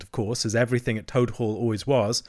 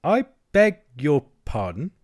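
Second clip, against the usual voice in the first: deliberate Rat, word by word; slow, pointed; 4 semitones higher, 4 dB louder than usual. A narrator's reading. Rat challenging Toad's assumption, slowly. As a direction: space the words out stiffly. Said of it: "said the rat slowly".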